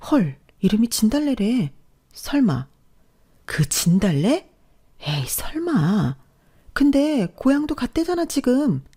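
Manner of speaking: high-pitched, drawn-out disbelief; high, gossipy office chatter; incredulous gossip. A narrator's reading. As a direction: play astonished coworkers buzzing over a rumour.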